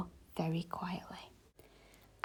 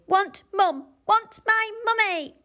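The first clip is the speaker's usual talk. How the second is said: put-on high, loud toddler demand; whiny baby; 13 semitones higher, 4 dB louder than usual. A narrator's reading. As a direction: insist like a small child.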